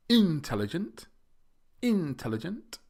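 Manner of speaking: intelligent as IN-telligent; IN punched high and loud in intelligent; wrong first-syllable stress. Shown as a demonstration. Teacher showing learners a mistake, IN stressed in intelligent. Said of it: "we don't say".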